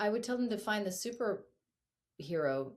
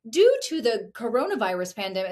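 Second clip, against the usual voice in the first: mock voicemail announcement; 5 semitones higher, 13 dB louder than usual. Mocking a voicemail recording.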